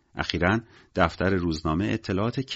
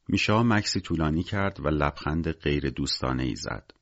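ordinary delivery

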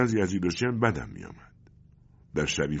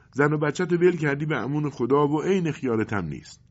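higher, louder reproachful wife; frowning reproach; 10 semitones higher, 5 dB louder than usual. Acted. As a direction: scold him with a frown in the voice. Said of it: "مادر اخمایش توی هم رفت". (سرزنش با اخم، صدای زیرتر و بلندتر)

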